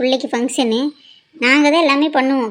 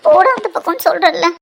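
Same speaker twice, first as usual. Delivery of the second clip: shouting him away; 4 semitones higher, 3 dB louder than usual. Acted.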